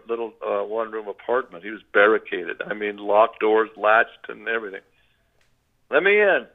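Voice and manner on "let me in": mildly raised, higher-pitched plea; calling through door. Retold or imitated. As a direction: call to be let in through a locked door. Acting out being locked out.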